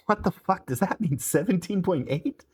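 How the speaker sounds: high-pitched baffled protest; lightly flustered, question lifting at the end; baffled and a touch indignant; mildly stammering. A narrator's reading. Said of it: "Jazz stammered".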